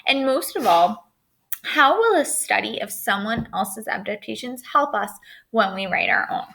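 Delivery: usual speaking voice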